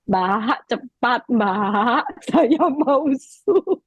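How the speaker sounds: very slow, drawn-out polite request; exaggeratedly slow re-enacted plea with a laugh; changed, softened intonation. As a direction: stretch each word as if correcting yourself, amused. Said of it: "kok ngomongnya berubah".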